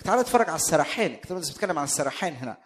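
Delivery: ordinary delivery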